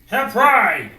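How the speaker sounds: sudden loud, insistent burst; loud inner voice. Acted. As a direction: blare it out like pride shouting in the head. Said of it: "hearing the loud voice in your brain".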